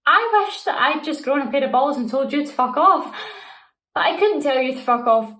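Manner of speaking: loud, bitter and furious; angry outburst. Acted. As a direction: spit out the regret. Heated confession to former lover.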